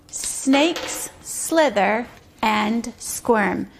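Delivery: very slow, one word at a time; slow message reading. Shown as a demonstration. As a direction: say each word separately, with clear pauses. Pointing out words while reading.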